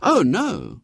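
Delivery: high, noble little refusal; a boy being nobly self-sacrificing. A narrator's reading. William refusing to leave his seasick mother, nobly. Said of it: "said William nobly".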